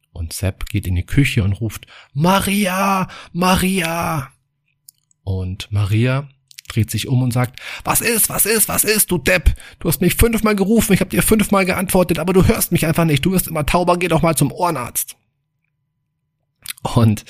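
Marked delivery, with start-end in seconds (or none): 2.1-4.3 high-pitched call across the house. Acted. Husband calling his wife from the bedroom, high call. Calls from kitchen doorway. 7.8-15.2 high-pitched exasperated wife voice; annoyed wife. Acted. Wife fed up with her deaf husband, high exasperated scolding.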